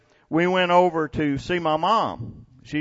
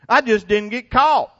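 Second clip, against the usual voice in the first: punchline; 7 semitones higher, 6 dB louder than usual. Real, not acted. Joke about never going to jail.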